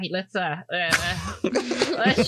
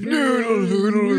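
put-on very old man; 4 semitones higher than usual. GM voices old clerk.

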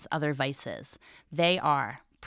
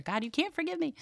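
the plea pitched much higher, rising on "me"; high pleading intonation; 13 semitones higher, faster than usual. Shown as a demonstration. Teacher trying out the plea with a high whine. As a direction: lift "God, you can't forgive me." way up. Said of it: "I'm trying to make different intonations here".

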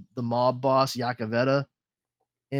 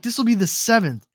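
imitated high-school girl; 7 semitones higher, 3 dB louder than usual. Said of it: "She's like".

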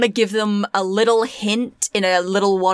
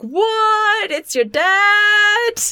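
shocked disbelief; 12 semitones higher, much slower than usual. Pretend. Players react to boss reveal.

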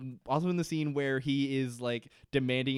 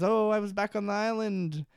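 Desmond; 7 semitones higher than usual. Retold or imitated. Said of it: "Desmond awakens from a nightmare".

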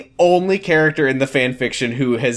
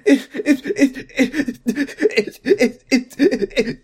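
high, stuttering mock sobs; crying too hard to talk; 13 semitones higher, much slower than usual. Kid trying to talk through tears, hammed-up hiccuping sobs. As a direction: choke on the word like a bawling kid. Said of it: "cry so hard that you, like, couldn't talk".

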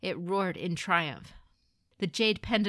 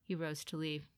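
plain reading voice

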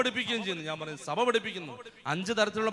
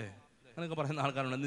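ordinary talk, nothing marked

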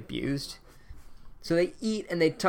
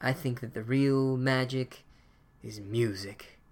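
dreamy Mumblecore; slower than usual. Retold or imitated. Headmaster musing to the students, slow and dreamy. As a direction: drift off into wistful wisdom. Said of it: "Mumblecore is like".

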